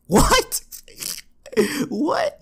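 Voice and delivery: rising baffled what with a laugh; stunned disbelief, laughing; high laughing what; incredulous what. Real, not acted. Incredulous reaction.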